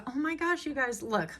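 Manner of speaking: high, breathy thrill over the card; gushing at a pretty card; excited gasp. Real, not acted. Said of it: "I get excited about the simplest things".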